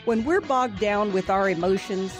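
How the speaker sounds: ordinary delivery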